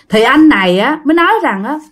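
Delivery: ordinary delivery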